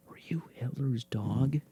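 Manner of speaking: conspiratorial whisper; mock-suspicious whisper to the dog. Real, not acted.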